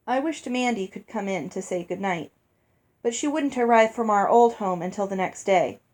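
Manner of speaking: plain reading voice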